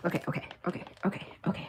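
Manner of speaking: whispered okay okay; hushed repeated okay. Real, not acted. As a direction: breathe the okays under your breath. Whispered okays.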